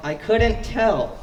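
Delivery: slow, dry sarcastic reply; deadpan the jab; dry sarcasm. Retold or imitated. A person answers Ryder's apology, slow dry sarcasm. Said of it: "And he was like".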